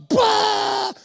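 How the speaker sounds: put-on high, loud childish "Bah!"; mock tantrum shout; toddler tantrum yell. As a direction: bellow it like a furious three-year-old. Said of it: "I was like".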